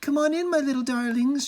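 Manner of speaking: high, eager calling voice. A narrator's reading. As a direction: call them in like a fond mother. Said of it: "she cried".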